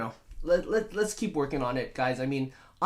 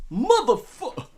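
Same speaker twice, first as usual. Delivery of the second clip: loud, high mock curse cut off short; hammed-up half-swallowed expletive; Mace Windu; 8 semitones higher, 6 dB louder, slower than usual. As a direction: burst into movie-tough-guy swearing, stopping mid-word. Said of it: "combination of Mace Windu and Pulp Fiction".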